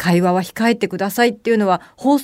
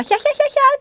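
high-pitched mock cackle, far above the normal voice; imitating a shrill cackle; much faster than usual. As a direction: ape the girl's squealing laugh. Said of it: "って笑った". (女の子の甲高い笑い声をまねて)

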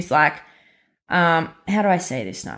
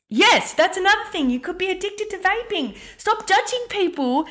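much higher, heated outburst about vaping judgement; worked-up rant; 11 semitones higher than usual. Real, not acted. Podcast host ranting at judgemental listeners, heated and high. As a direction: pitch it up high and let the annoyance boil over. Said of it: "it makes me so angry".

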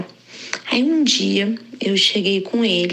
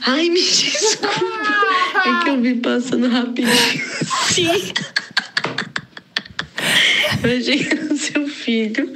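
apologetic doorman laughing; 8 semitones higher than usual. Retold or imitated. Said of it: "Aí ele".

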